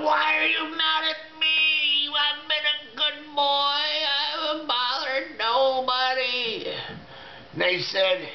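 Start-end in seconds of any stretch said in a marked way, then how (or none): 0.0-6.7 pleading shout. Retold or imitated. Pleads with the aliens.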